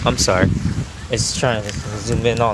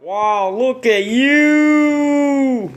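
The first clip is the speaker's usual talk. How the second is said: high, slow, drawn-out wow; delighted admiring; 11 semitones higher, 3 dB louder, much slower than usual. Real, not acted. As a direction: marvel at the costume. Admiring child in costume.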